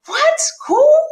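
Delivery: shrieked high and loud in disbelief; outraged disbelief. Retold or imitated. Woman reacting to her boyfriend calling pregnancy cool, shrieking disbelief. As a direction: yelp it, appalled. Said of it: "And I'm like".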